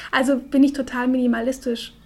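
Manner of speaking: ordinary talk, nothing marked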